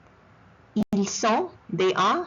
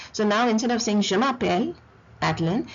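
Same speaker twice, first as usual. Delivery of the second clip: ordinary talk, nothing marked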